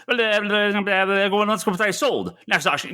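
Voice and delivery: rattling off an auctioneer's patter; fast auctioneer. Retold or imitated. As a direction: rush it like an auctioneer. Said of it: "auctions where it's like".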